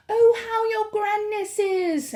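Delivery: put-on slow, high, simpering old witch voice; burbling silly. A narrator's reading. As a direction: gush foolishly over her. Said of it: "burbling their silly sentences".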